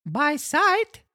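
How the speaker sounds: very high puppet reply; marionette voice. Acted. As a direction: answer in a tiny squeaky puppet voice. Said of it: "answered the marionette".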